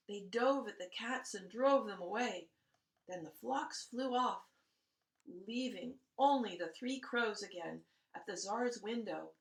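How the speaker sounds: plain reading voice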